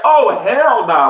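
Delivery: retold shocked hell nah; shocked disbelief. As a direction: stretch it in horror. Shocked reaction in story.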